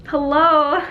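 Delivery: loud, high "hello?" of disbelief; exasperated hello. Real, not acted. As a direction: call out the nonsense. Scoffing at the doctors.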